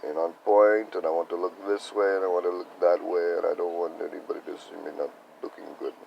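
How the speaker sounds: ordinary talk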